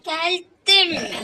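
loud cry of arrival; triumphant shout. Real, not acted. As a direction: shout it out in relief. Reaching the goal. (sevinçle bağırarak)

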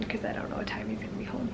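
fast quiet whisper; whispered aside. Real, not acted. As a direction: rush it out under your breath.

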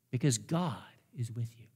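soft, sinking close; hushed. Acted. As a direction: let it fall to a near whisper. Preacher drops to hushed close.

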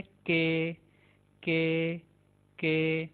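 "के" like English K, first one slow; letter K. Shown as a demonstration. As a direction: say "के" clearly. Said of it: "English के के के जैसी". ("के" अंग्रेज़ी के जैसा)

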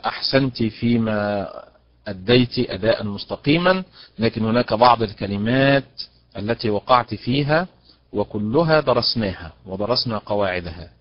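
ordinary delivery

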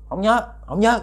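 high, strange lost-ghost reply. Retold or imitated. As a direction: answer like a lost child ghost. Ghost cannot remember home.

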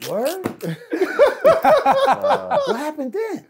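high-pitched, loud helpless laughter; laughing so hard the words barely come out. Real, not acted. Cracking up at the story.

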